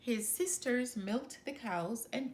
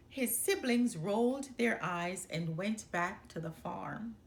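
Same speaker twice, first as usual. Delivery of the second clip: plain reading voice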